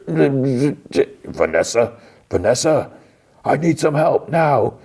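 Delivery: high, stretched cry for Vanessa, then a call for help; panicked plea. Retold or imitated. Husband losing his wife, crying out in panic. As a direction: call her name, drawn out and desperate.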